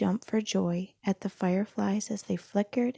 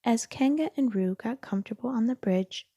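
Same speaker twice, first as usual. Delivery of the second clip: plain reading voice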